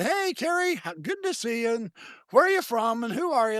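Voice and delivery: high-pitched, chatty hello; retold eager greeting. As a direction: imitate a friendly newcomer-welcomer. Voices another member greeting him.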